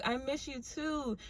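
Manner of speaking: high, soft, slow mimicked cooing; gushing back. [name] answering the waitress she missed, high soft cooing. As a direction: coo it back softly, drawing it out.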